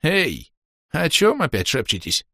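usual speaking voice

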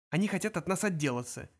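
ordinary delivery

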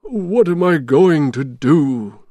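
troubled storybook Santa; worried Santa. A narrator's reading. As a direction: fret slowly to yourself. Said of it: "Santa Claus thought".

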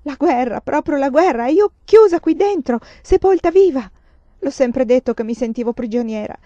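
building to a high, loud wail; rising into a frantic scream of being trapped; raving shout. Acted. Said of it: "che gridava, smaniava".

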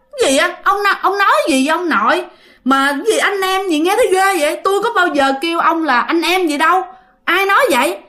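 high-pitched, indignant disbelief; startled protest. Acted. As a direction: act out a girl shocked by a false claim. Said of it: "bà ngoại mới giật mình". (giọng giật mình, cãi lại, lên cao)

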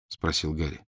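ordinary delivery

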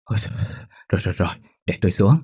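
quick, stuttered giving-in; shivering with fear. A narrator's reading. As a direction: give in through chattering shivers. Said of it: "run cầm cập mà nói".

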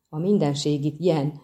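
ordinary delivery

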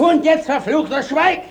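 high, sharp order to be silent; angry command. Acted. The judge orders the accused to hold his tongue, high and angry. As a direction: shut him up at once.

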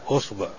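أصبع with an opening "u": usba'; damma on hamza. Shown as a demonstration. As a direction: say أصبع starting on a short "u". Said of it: "وضمها".

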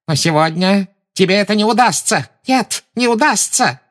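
triumphant gloating. A narrator's reading. Umbridge gloating.

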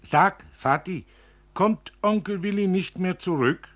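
leicht höhere, fragende Kinderstimme. Acted. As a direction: als kleines Mädchen den Vater fragen. Kind fragt den Vater. (zaghafte Kinderfrage)

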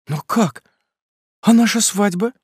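put-on high, stunned young woman near tears; shocked plea. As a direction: cry out in disbelief. Bride hears wedding is off.

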